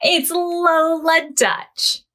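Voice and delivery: ordinary delivery, nothing marked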